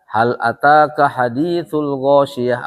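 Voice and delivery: slow, measured Arabic reading of hal ataka hadithul ghoshiyah; Quran recitation. Shown as a demonstration. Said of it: "Apa artinya?".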